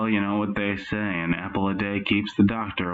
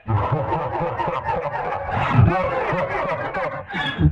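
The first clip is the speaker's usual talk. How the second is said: airy giggling fit breaking out; burst of laughter; 6 semitones higher than usual. Real, not acted. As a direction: crack up helplessly. Interviewer cracks up.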